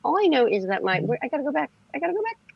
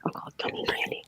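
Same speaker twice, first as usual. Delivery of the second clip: whisper; 9 dB quieter, faster than usual. Shown as a demonstration. Continues whisper.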